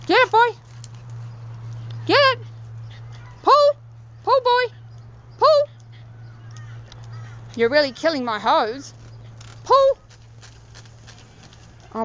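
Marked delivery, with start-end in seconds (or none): 0.0-5.7 tug of war. Real, not acted. Dog pulling the hose. 9.6-10.0 high, loud cheering on a tug; tug of war. Real, not acted. Dog pulling the hose.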